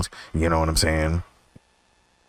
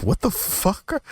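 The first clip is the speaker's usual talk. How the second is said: slow, high what the fuck; stunned disbelief; slower than usual. Retold or imitated. Waking up late in the afternoon, slow high disbelief. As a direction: drag it out in shock.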